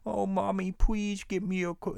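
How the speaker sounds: high whiny child voice, mocked; mocking baby talk. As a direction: whine like a kid begging mom for something. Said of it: "when they do the baby talk voice".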